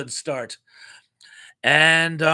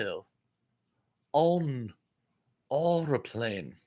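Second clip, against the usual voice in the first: slow mock-posh English accent, drawn-out words; Graham Chapman, posh English; 4 semitones lower, 5 dB quieter, slower than usual. Teasing English restaurant customers, mock posh English accent. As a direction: put on a plummy English accent, softly. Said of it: "Graham Chapman thing".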